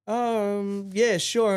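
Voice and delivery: high, hesitant acted-out yes; drawn-out um, then agree uneasily; awkward acceptance. Awkwardly accepting a cigarette in club.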